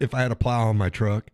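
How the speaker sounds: usual speaking voice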